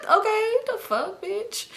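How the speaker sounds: high, incredulous comeback; mock indignant squeal. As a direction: scoff at the accusation in a squeaky high pitch. Scoffing at being called a crier.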